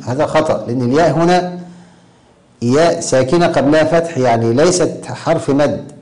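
ordinary delivery

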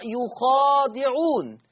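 slow "يخادعون": ya with damma, then kha with fatha and a long alif; Warsh reading yukhadiʕun. Shown as a demonstration. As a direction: recite "يخادعون" slowly as yukhaadiʕun. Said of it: "يضم الياء ويفتح الخاء وألف بعدها". (قراءة ورش "يخادعون" بضم الياء ومد الألف بعد الخاء)

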